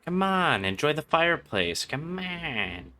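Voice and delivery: ordinary delivery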